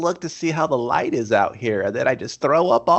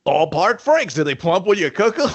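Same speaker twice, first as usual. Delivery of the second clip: laughing mock quote; 6 semitones higher than usual. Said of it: "He's like".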